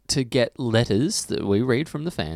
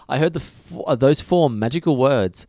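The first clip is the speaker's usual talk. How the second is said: ordinary talk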